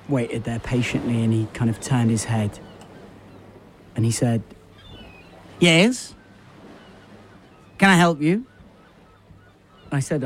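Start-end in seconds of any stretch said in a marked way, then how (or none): 5.6-8.4 higher, louder put-on kiosk man voice; a curt shopkeeper answering the customer. Kiosk man asks the customer what he wants, curt put-on voice.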